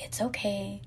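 hushed, gentle whisper, very soft; soothing voice. Retold or imitated. Mom soothing her upset son, soft whisper. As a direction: soothe a child in a breathy hush. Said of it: "tell him in a soothing voice".

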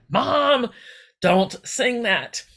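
mock-exasperated daughter pleading, slowed right down; exasperated plea. Daughter begging her mom to stop singing, exasperated and slow.

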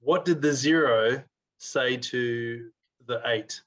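slow, deliberate riddle; slow joke setup. Acted. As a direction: draw out the setup slowly.

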